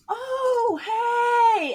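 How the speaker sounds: high, drawn-out sing-out hello; loud, high mock-friendly call; mock oblivious greeting. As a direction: imitate a clueless spectator spotting a friend. Mocks oblivious chatting spectators.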